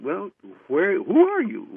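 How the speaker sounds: mildly acted bewilderment, pitch leaping on "who are you"; baffled questioning. Retold baffled questioning of mysterious stranger.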